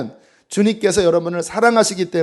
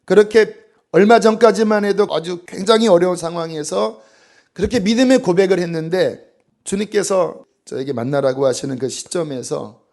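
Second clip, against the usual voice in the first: ordinary talk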